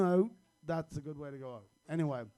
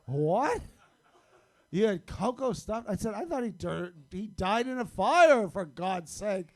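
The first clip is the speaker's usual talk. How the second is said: shocked disbelief; 5 semitones higher, 7 dB louder than usual. Retold or imitated. Shocked at the stuffed dog.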